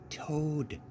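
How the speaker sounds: spooky Frog. A narrator's reading. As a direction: set a ghostly mood.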